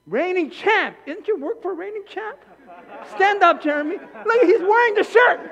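loud, high cheering; excited hype. Real, not acted. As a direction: pump up the crowd. Hyping a congregant up.

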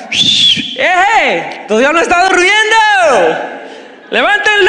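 high, loud taunting shout, slow on the middle words; mocking trash talk. Elijah taunting the prophets of Baal, high loud mocking shout. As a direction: jeer at them as if their god is asleep. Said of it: "Empezó a hablarles basura a los profetas".

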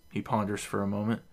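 usual speaking voice

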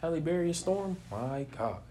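normal talking voice

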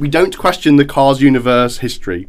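loud, emphatic shutdown of questions; mock-stern insistence. Real, not acted. Co-host shutting down questions about Cars lore, mock-stern insistence.

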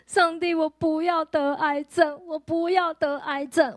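slow, tearful pleading to God; retold sob-laden prayer, slow; retold crying plea. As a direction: beg slowly as if weeping again. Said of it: "我就突然大哭".

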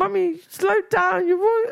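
put-on high, slow pleading child; whiny kid voice. Child whining at his mum to slow down. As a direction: whine it like a little boy begging his mum. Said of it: "he's like".

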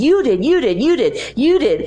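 high, rapid-fire accusation; high-pitched retold yelling; mock screaming. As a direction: imitate someone shrieking an accusation, without full volume. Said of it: "he starts, like, screaming".

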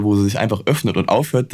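ordinary talk, nothing marked

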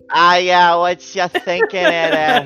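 half-laughing imitation of a bad drunken Australian accent. Showing a friend how the guy's bad Australian accent sounded, in a mock drunk Australian voice. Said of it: "How good was the accent?".

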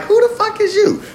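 hammed-up who-are-you squeal; incredulous. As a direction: shoot it up in mock outrage. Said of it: "you're like".

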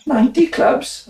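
pitched high, slow disbelief; incredulous. Retold or imitated. Footballer retelling his shock at the tiny number. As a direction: stretch out the shock of four in ninety. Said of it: "I said".

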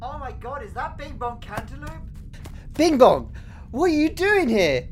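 high, gushing fan squeal; starstruck excitement. Acted. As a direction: gasp like you've met your hero. Fan recognizes celebrity.

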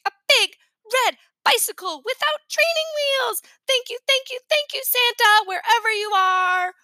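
put-on very high squealing child voice; excited shrieking. A narrator's reading. Little brother thanking Santa for a bicycle, shrieking with delight. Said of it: "he shrieked".

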